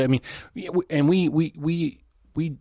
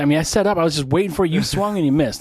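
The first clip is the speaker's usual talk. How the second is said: pitched up, pushing each phrase at a friend; animated exasperation; 6 semitones higher, 4 dB louder than usual. Real, not acted. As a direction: playfully scold someone who missed your joke. Chiding friend for missing setup.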